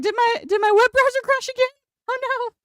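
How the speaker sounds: high, fast panicky squeak; sudden alarm, pitch shooting way up; panicked surprise. Retold or imitated. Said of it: "I was like".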